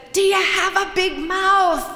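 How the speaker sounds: high, loud over blaring music; Johnny's question. Retold or imitated. Johnny calling to the narrator over blaring music, high and loud. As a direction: call it out over the loud party. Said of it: "he says".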